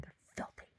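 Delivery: gleeful whispered gossip. Real, not acted. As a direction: whisper it as a naughty secret. Gossiping about the filthy hedges.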